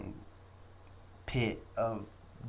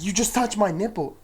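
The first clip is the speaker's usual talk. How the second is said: Karen accusing; 10 semitones higher, 8 dB louder than usual. Retold or imitated. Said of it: "She's like".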